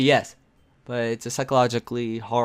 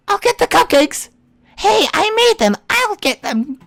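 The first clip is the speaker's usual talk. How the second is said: put-on high, loud eager anime girl; excited girl voice; 5 dB louder than usual. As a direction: rush to grab the cupcakes.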